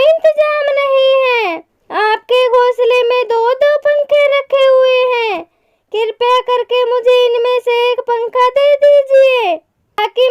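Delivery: high-pitched storybook mother asking for help; pleading mother bird. Acted. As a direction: plead politely for your chick, pitched well up. (विनती करती माँ चिड़िया की ऊँची आवाज़)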